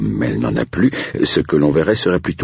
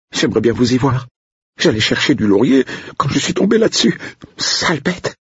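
upset cook; 12 semitones higher than usual. Acted. Cook complaining to Blake about the cat, high upset voice. Odile retorts, still shaken.

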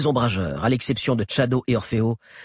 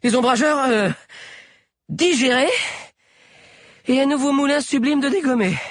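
loud, very high-pitched delivery; high character voice; 4 dB louder than usual. A narrator's reading. Sabi sums up the fight while running, high raised voice. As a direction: break it into pieces, pitched high.

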